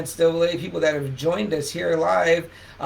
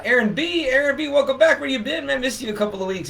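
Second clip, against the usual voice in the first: excited greeting; 9 semitones higher than usual. Real, not acted. Greeting a returning viewer.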